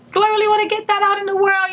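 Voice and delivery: high, quick, level-pitched self-quote. Retold or imitated. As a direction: voice your own doubts high and fast. Quoting own doubts before posting.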